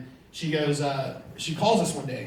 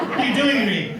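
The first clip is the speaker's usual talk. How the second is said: louder, higher, laughing mock complaint; laughing mock plea; 6 semitones higher, 9 dB louder than usual. Complaining to the workout trainer, laughing mock plea. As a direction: whine at the trainer while laughing.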